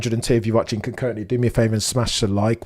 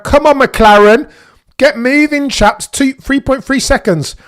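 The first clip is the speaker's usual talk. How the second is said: much higher, louder, slow yell at the crew; loud impatient cry at a slow pit stop; frustrated urging; 12 semitones higher, 7 dB louder than usual. Real, not acted. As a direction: shout the pit crew on, exasperated.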